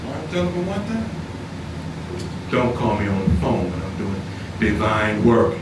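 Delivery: low, calm quoted warning to his wife; not hollering. Retold or imitated. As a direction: tell her firmly without raising your voice. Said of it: "and I don't holler".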